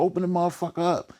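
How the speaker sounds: high, fast imitation of angry men at the door; high mock yell. Men outside the bus yelling to get in, high and fast. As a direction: pitch it high and fast like a mob shouting.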